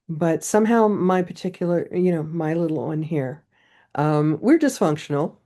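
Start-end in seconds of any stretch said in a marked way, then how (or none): none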